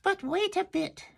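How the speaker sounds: very high squeaky oyster voices; tiny shellfish squeaking a protest; little oysters. Acted. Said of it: "the oysters said".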